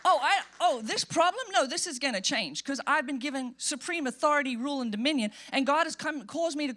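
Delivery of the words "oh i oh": ordinary talk, nothing marked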